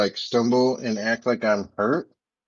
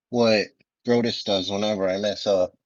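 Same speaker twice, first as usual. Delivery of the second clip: usual speaking voice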